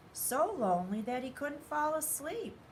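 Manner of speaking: usual speaking voice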